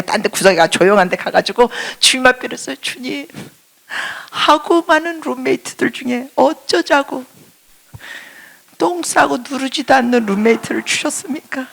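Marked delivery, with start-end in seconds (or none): 2.8-11.7 high, whiny complaining to God; pitched-up pleading rant; pouring out complaints. Retold or imitated. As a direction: vent every grievance, half laughing. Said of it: "막 쏟아냈어요".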